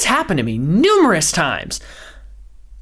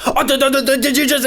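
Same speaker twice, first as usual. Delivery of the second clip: higher, sputtering mock outrage; fake upset; 7 semitones higher than usual. Mocking sports-show hosts, sputtering fake outrage. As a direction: splutter like a TV pundit faking anger. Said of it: "they do the fake upset".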